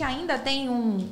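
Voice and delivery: usual speaking voice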